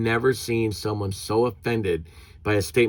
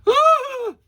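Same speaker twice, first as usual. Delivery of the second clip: shrill high mock gasp, much higher and louder; mock shocked gasp; 6 dB louder than usual. A bystander offended by a curse at a ballgame, shrill mock gasp. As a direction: act out a prude's shocked gasp. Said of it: "this person".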